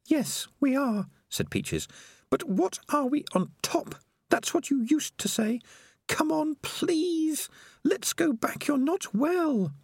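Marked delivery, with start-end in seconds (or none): 0.1-1.1 put-on high, earnest young rat girl; Peaches, pleading and firm; young female rat voice. A narrator's reading. 2.3-9.7 put-on high, earnest young rat girl; Peaches, pleading and firm; young female rat voice. A narrator's reading. Peaches coaxing.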